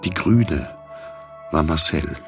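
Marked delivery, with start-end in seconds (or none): none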